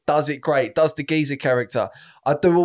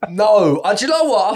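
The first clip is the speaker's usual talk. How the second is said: ordinary talk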